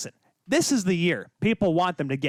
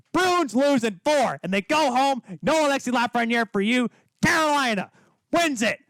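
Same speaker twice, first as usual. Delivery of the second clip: hyped up; 5 semitones higher than usual. Real, not acted. Host hyping Carolina's sweep over Boston fans. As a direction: pump up the crowd. Fired-up hockey prediction rant.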